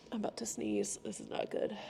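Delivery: whispered, holding off a sneeze; very soft, unvoiced aside; pre-sneeze aside. Real, not acted. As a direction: whisper a worried warning before sneezing. Aside about coming sneeze.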